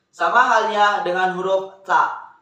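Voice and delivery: ordinary talk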